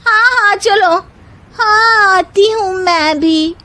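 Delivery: put-on high young woman voices agreeing; eager bahus. A narrator's reading. Other bahus agree to go make parathas, high voices. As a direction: chime in quickly.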